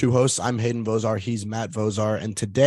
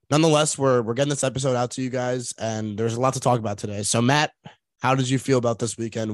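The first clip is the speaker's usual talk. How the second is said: usual speaking voice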